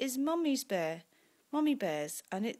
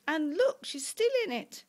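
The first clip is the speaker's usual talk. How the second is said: high, excited little bear voice; story-time astonished cub; Baby Bear; 9 semitones higher, 5 dB louder than usual. A narrator's reading. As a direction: call out the discovery, high and loud. Baby Bear discovers Goldilocks.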